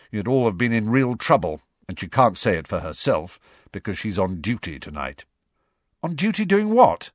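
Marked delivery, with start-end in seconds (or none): 6.0-7.1 high, louder prompt question; quick, eager question. A narrator's reading. Son asking about mother's duty, quick and curious. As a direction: jump straight in with the question. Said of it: "said Ron quickly".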